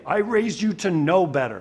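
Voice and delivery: lightly acted parent scolding a kid. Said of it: "it's what you say to your kid".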